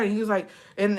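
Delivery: ordinary delivery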